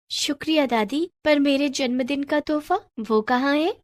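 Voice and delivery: put-on high girlish voice; eager young girl. Girl thanks grandmother and asks for her gift, eager. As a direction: ask sweetly for your present.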